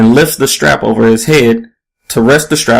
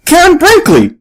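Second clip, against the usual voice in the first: much higher excited shout of her name; belted out; 3 dB louder than usual. A narrator's reading. Young fan recognizing the reporter, belting out her name. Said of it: "belts out".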